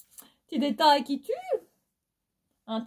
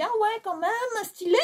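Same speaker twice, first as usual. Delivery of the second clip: high-pitched, amused admiration ending in a laugh; impressed reaction; 11 semitones higher than usual. Retold or imitated. Shopper recalling first spotting the skirt in the aisle, impressed. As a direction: recall being impressed, voice jumping up. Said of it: "je me suis dit".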